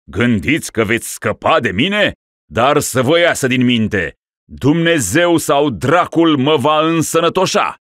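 loud, high, ranting curse; defiant shout. Acted. Ruler defying the boyars from his sickbed. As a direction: hurl it at them defiantly. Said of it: "strigă lăpușneanul".

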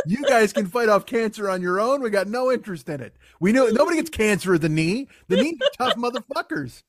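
high-pitched acted outrage; worn-out workers angrily refusing to help; mock-furious, high and emphatic; indignant refusal. Knees angrily refuse the other bones.

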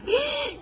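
high-pitched mock gasp; demonstrated gasp. Shown as a demonstration. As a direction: gasp sharply, left in suspense. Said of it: "me dejaste con la, así". (jadeo agudo fingido)

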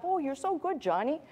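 high, soft cooing praise, mocking; gushing parent. Parent praising a kid who messes up, mock cooing. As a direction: coo it sweetly as an overindulgent parent. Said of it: "the way we now have to train our children".

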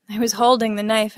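ordinary delivery, nothing marked

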